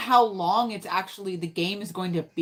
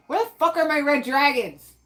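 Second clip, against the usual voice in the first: frustrated outburst; 8 semitones higher, 6 dB louder than usual. Real, not acted. Can't find the dragons in game.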